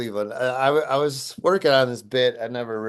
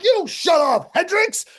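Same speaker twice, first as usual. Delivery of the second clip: very high, loud, furious protest; mad yelling; 5 dB louder than usual. Retold or imitated. Drunk guitarist scolding the manager over [name], mad yelling. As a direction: act out losing your temper over the music.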